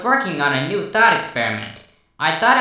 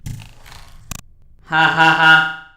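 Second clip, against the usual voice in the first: slow robotic laugh; much slower than usual. Acted. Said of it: "Twilight shouted over my laughter".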